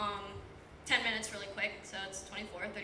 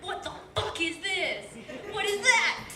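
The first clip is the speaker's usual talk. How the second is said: higher and louder, mock disbelief; shocked outburst; 8 semitones higher, 5 dB louder than usual. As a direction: blurt it out in retold shock. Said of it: "I'm like".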